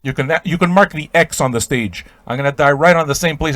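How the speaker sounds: ordinary delivery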